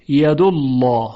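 ید الله as "yadullaw", the l thick and dark; full, heavy lam in ید الله. Shown as a demonstration. Teacher repeating the damma example, heavy ید الله. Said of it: "لام الله تفخیم بشه".

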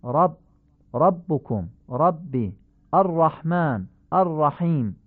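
dark, back short 'a' after every 'ر'; heavy Arabic ra. Shown as a demonstration. Teacher giving examples of ra, heavy fatha. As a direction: recite 'رب' and 'الرحمن' with full-mouthed 'ro'.

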